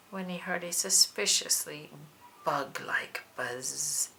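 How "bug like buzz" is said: slow, softer, barely voiced bug-like buzz; buzzy whisper. A narrator's reading. Narrator evokes the suspicious insect sound, whispered slowly. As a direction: let the words hum like a fly.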